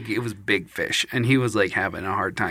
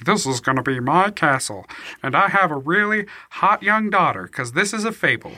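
pompous lord; 4 semitones higher than usual. Retold or imitated. New lord voiced in retold fable.

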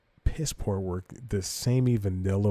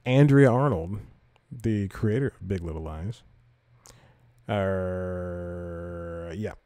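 normal talking voice

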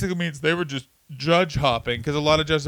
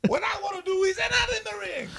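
extremely high, softer squeal; Pat Patterson; 6 dB quieter than usual. Pretend. Wrestler making a sleazy confession, in a squealed comic voice. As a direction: squeak it out in a cartoon falsetto. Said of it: "that's what Pat Patterson sounds like".

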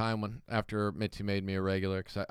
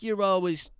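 mock shout; 6 semitones higher, slower than usual. Rival comic's insult retold.

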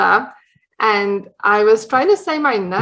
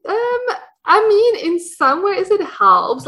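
laughing; 11 semitones higher, slower than usual. Real, not acted. Laughing after accent question.

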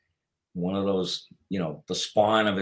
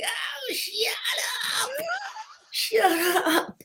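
coy protest; much slower than usual. Retold or imitated. Bashful mock protest to friends.

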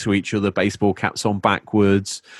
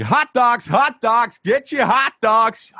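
much higher, slow drawn-out street vendor cry; hot dog vendor call; slower than usual. Retold or imitated. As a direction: hawk it like a New York vendor.

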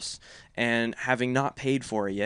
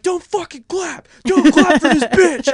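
high, slow yelling, much louder; furious shout; 12 dB louder, slower than usual. Retold or imitated. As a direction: bellow at the friend to stop clapping. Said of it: "Just went off".

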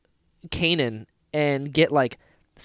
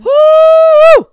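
a loud high woo; cheer; 17 dB louder than usual. Real, not acted. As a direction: whoop with joy. Cheering at the memory.

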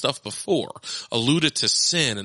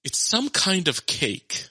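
put-on slow, higher-pitched child's guess; unsure young daughter; 6 semitones higher, slower than usual. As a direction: offer the answer slowly, like a puzzled kid. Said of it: "she goes".

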